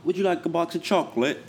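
high-pitched put-on British voice; hammed-up British politeness; mock British accent. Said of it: "had a good little accent".

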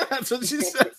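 high, laughing agreement. Real, not acted. Co-hosts cracking up over trailer quote, laughing. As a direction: squeeze the words out through laughter.

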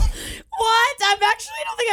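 shocked high shout; high disbelieving what; surprised. Real, not acted. Shocked at Golden Corral.

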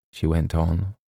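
ordinary delivery

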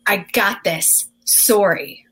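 slow, low, self-assured announcement; retold proud pronouncement; mock confidence. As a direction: present it slowly like a sure winner. Retells confidently offering her rhyme.

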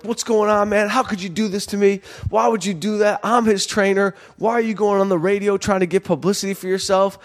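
high-pitched, indignant complaint at a friend; upset confrontation. Retold or imitated. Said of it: "I'm super upset".

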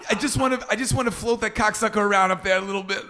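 high-pitched comic caller voice; prank caller. Retold or imitated. Caller asking balloon rental to float his son, prank caller. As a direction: imitate an absurd request, high and amused. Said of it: "the one where he calls".

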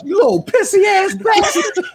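high, squawking mock scolding; high-pitched, hammed-up angry scolding; cussing grandmother. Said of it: "She cussing us".

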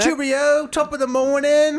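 high, loud mock-British cry; over-the-top British accent, drawn out. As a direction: ham up a cheery British greeting. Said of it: "with a British accent".

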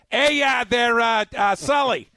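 hammed-up Boston accent, pitching hard; mock Boston accent. Coach recruiting a Boston receiver, in a mock Boston accent. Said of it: "wide receiver out of Boston".

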